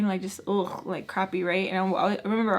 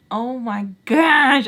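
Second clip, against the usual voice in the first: loud, drawn-out exasperated outburst; so mad; 3 semitones higher, 9 dB louder, much slower than usual. Retold or imitated. Granddaughter reacting to grandma's demand, mad and loud. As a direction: stretch it out, loudly fed up. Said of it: "I remember just being so mad".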